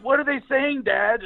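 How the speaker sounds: put-on small boy questioning his father; young son. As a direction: ask like a puzzled ten-year-old. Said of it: "my son's sitting there going".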